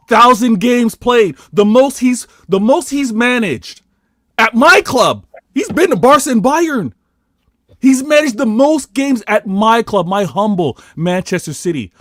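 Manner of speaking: high, loud, hammering each phrase; fired-up boasting, pitch way up; impassioned fan rant. Real, not acted. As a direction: proclaim it to the doubters at full voice. Fan ranting about his club.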